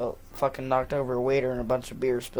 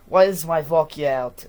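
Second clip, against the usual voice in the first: louder mock-Russian demand; Russian accent; 7 dB louder than usual. Dad demanding his vodka, in a mock Russian accent. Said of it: "he does not say vodka like that".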